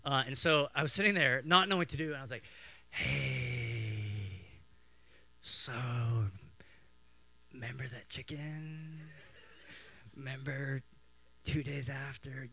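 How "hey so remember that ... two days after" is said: slow, quiet, drawn-out needling; sly hushed teasing. Retold or imitated. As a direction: draw it out with long teasing pauses. Bored brother needling sister in church.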